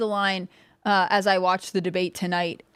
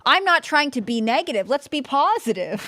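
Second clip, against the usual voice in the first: higher, louder mocking voice; mock Kamala voice; 6 semitones higher, 4 dB louder than usual. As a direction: imitate her cheerfully.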